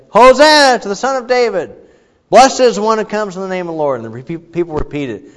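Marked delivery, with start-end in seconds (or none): 0.1-4.0 loud, high crowd answer; people's response. Retold or imitated. Preacher voicing the people's loud high response to the priest. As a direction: belt it back like the congregation. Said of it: "And the people would say".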